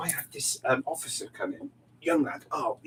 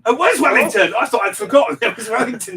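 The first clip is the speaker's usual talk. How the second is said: delighted realization; 6 semitones higher, 10 dB louder than usual. Real, not acted. Realizing she remembered right.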